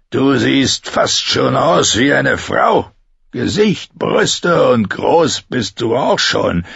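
put-on a drunk man goading the girl; drunken taunting. Betrunkener Bluthund verhöhnt Sansa. (betrunken spottend)

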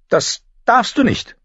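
put-on high, loud child's protest; protesting girl. Arya protests against being sent home, alarmed.

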